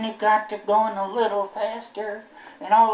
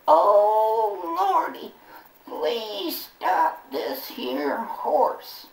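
slow, high pleading prayer; mock prayer; 7 semitones higher, much slower than usual. [name] begging the Lord to stop the horse, slow and high. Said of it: "I prayed".

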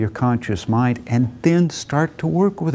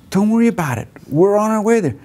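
higher, easygoing reassurance; breezy friend; 7 semitones higher, faster than usual. Retold or imitated. Walter brushing off Arthur's question while driving, breezy. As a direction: wave the worry off lightly.

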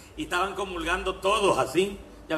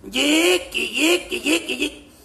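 rhythmic panting grunts; 5 semitones higher, 4 dB louder, slower than usual. Acted.

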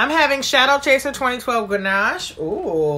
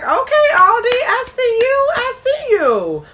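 high, loud mock-impressed voice, pitched up; impressed reaction; 7 dB louder than usual. Host retells her reaction to the Aldi rosé winning, in a high, loud mock-impressed voice. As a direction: play the impressed shopper calling out the store. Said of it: "and I was like".

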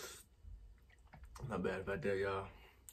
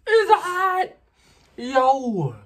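high pained yell at the burn; shouting through the burn; 14 dB louder, much slower than usual. Real, not acted. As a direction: cry out in real pain. Spice hits hard.

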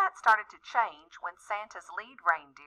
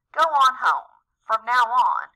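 loud, high stern coach; stern shout; 6 semitones higher, 8 dB louder than usual. Acted. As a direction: order him away sternly. Coach banishing Rudolph.

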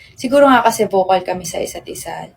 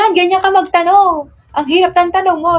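exasperated partner; 8 semitones higher, 3 dB louder than usual. Retold or imitated. Retelling partner's exasperated reply.

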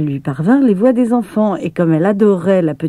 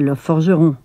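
usual speaking voice

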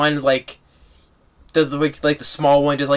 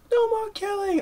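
imitated scream; 5 dB quieter than usual. Comparing the two films, a high mock scream. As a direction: scream it high but soft. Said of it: "just way he screams".

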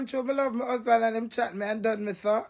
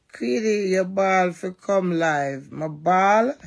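usual speaking voice

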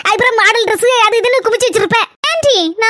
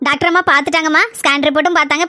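ordinary talk

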